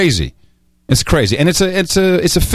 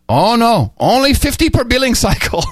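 imitating an Indian call-centre agent, higher voice; mock Indian accent, pitched up; 9 semitones higher than usual. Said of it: "I'm sorry to do the accent".

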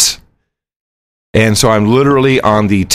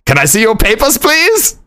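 high mocking officer voice; high mock border agent asking for papers; 11 semitones higher than usual. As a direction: ape the border guard with a high sneer. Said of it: "he's like".

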